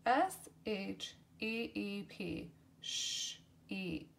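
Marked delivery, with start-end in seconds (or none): 2.8-4.1 sheep breathed in a whisper. Shown as a demonstration. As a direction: hush the word sheep. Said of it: "word said twice".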